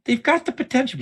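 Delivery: high, airy teacher parody; mocking teacher voice. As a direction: imitate a condescending teacher. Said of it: "the teachers are like".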